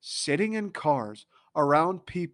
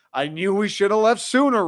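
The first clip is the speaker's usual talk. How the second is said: louder, higher mock dad rant; dad's traffic rant; 6 semitones higher, 6 dB louder than usual.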